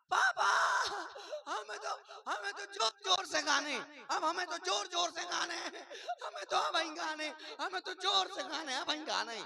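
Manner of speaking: ऊँची आवाज़ में रोती-हँसती चीख, बच्चे जैसी; ज़िद्दी बच्चा चिल्लाकर रो रहा; रोते हुए ज़िद. Retold or imitated. (बच्चा पापा पर ज़ोर से रोते हुए चिल्लाता)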